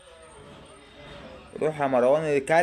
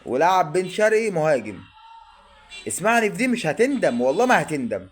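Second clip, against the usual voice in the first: loud urging; 5 semitones higher, 5 dB louder than usual. Real, not acted. Fan urging coach on TV.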